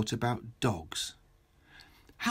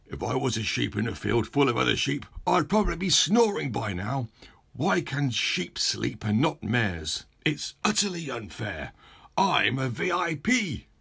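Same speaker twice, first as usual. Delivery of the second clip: cross mayor. Acted. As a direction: build from a grumble to an indignant complaint. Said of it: "started getting cross again".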